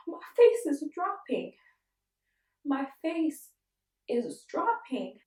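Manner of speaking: high, loud, drawn-out alarm; panicking. Retold or imitated. Patient on the phone to friends as face drops, panicking. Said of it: "And I'm panicking now".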